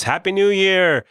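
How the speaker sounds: New Year cheer. Acted. Host describing a New Year party, celebratory cheer. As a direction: cheer it like a midnight crowd.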